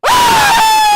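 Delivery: long, full-volume high screech; piercing scream, imitating the screamer; imitated scream. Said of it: "she's always screaming".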